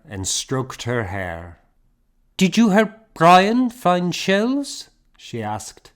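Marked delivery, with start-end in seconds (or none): 2.4-4.9 high, louder motherly question; lightly voiced plump woman. A narrator's reading. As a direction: ask the child sweetly. Fiona voiced.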